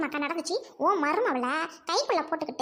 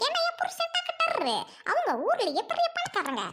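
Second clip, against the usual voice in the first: high, slow, showy boast; boastful brag; 10 semitones higher than usual. Acted. Proud wife slowly boasting that her husband is a doctor. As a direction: lord it over them. Bragging about rich husband.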